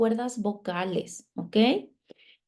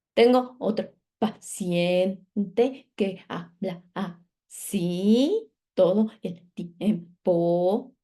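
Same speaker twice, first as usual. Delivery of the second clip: very slow, vowels stretched long; slow, elongated, sing-song drawl; slow drawn-out speech; much slower than usual. Shown as a demonstration.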